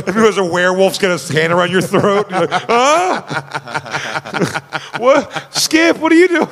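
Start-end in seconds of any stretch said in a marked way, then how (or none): 2.7-3.2 high cry of alarm; hammed-up startled yelp; mock startled gasp. Imitates victim grabbed by werewolf. 5.0-6.5 high, loud alarmed questioning; hammed-up high-pitched panic; mock panicked victim. Acts victim alarmed at friend.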